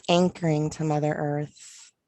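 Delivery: ordinary talk, nothing marked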